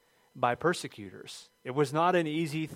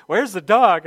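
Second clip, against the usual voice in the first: high, loud acted demand; imitating alarmed wife; 6 semitones higher, 13 dB louder, slower than usual.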